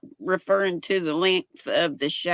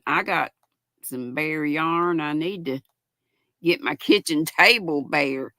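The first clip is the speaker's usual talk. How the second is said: ordinary delivery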